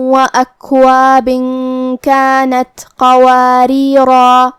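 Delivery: slow, drawn-out melodic tajweed chant; Quran recitation. Shown as a demonstration. Teacher recites the passage for the class, slow melodic tajweed. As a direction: stretch every vowel in a measured recitation.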